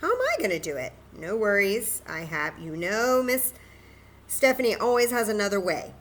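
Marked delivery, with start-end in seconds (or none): none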